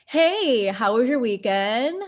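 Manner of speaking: high, loud, cheery opener; overly bright greeting. Shown as a demonstration. Coworker greeting someone on Monday, high, loud and cheery. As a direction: chirp it like a breezy coworker. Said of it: "another one".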